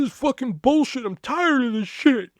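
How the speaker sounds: high, upset mock outburst; hammed-up high-pitched fed-up wail; imitated crying. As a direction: protest as a tearful coworker would.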